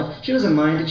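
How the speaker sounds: ordinary talk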